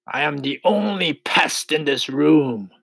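high-pitched, grinning boast; grinning centipede. A narrator's reading. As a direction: crow it proudly as a centipede. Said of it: "still grinning away".

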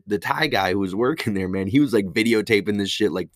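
ordinary delivery

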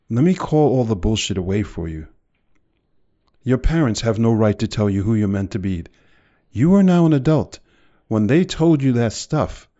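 loud, preaching fire to the crowd; booming motivational speech. Acted. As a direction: push every line out like a rally. Fiery motivational rant.

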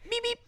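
high little horn beep; imitated horn beep. Friend imitating a truck horn, high squeaky beep. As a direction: mimic a puny vehicle horn going off. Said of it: "It went, it went".

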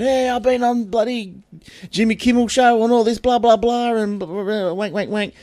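high-pitched, dismissive blah-blah imitation; mock sales patter. Street CD seller bragging to a passing tourist, high mock patter.

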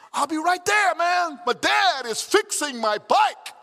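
high, loud proud shout; excited kid's shout. Retold or imitated. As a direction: boast it to the friends down the street. Son shouting to his friends.

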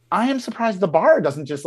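ordinary talk, nothing marked

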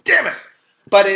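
loud, high playful outburst; mock frustration. Real, not acted. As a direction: blurt it out in fun. Jokes about missing luck in sex.

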